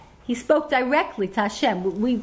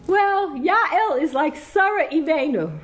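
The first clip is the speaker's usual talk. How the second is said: higher, slow mock of her husband, laughing through it; imitating husband; 6 semitones higher, slower than usual. Retelling her husband's birth speech, a higher, laughing imitation. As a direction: ham up your husband's proud speech line, laughing. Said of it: "He said".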